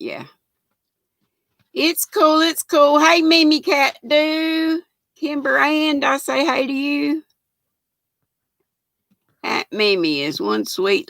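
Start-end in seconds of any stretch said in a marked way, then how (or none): 1.7-3.0 reassuring. Real, not acted. Reassuring a viewer, pitch up. 3.0-7.2 cheery greeting. Real, not acted. Greeting viewers, high pitch.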